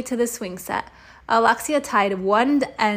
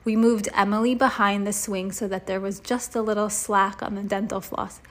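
ordinary delivery, nothing marked

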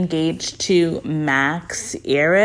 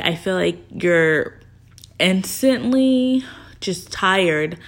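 ordinary delivery

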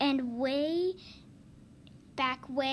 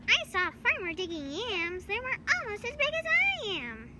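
squeaky mosquito voice; 8 semitones higher than usual. Acted. Mosquito bragging about the yams.

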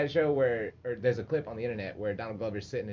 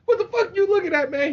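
high, loud confrontational yell; mock angry shout; 13 dB louder than usual. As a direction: challenge him at full volume. Retold inmate confronts Donald Glover.

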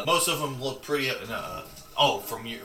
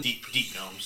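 ordinary delivery

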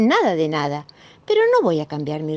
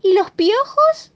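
much higher, slow pointed question; little sister; 14 semitones higher, 5 dB louder, slower than usual. Acted. Sister asking Marina about the lice, nosy. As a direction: pipe up like a nosy younger sister.

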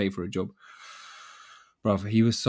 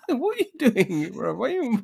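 high laughing question; laughing disbelief; 3 dB louder than usual. Real, not acted. Telling a friend about the handyman, laughing high.

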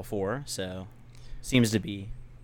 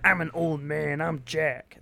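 put-on grumpy old man; 5 semitones higher than usual. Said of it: "That's a terrible old man voice".

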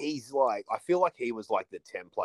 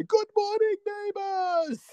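much higher, beaming call out; cheerful Akeem greeting; 4 dB louder, slower than usual. Retold or imitated. Akeem calling to New York neighbours, cheerful. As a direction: sing it out joyfully to the street.

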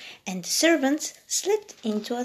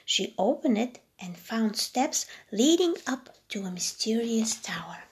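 usual speaking voice

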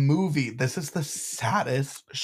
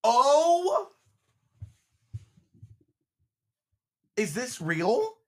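much higher, loud, stunned; shocked gasp; 10 semitones higher, 6 dB louder, much slower than usual. Real, not acted. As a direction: gasp in real disbelief at the news. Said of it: "Okay, sorry".

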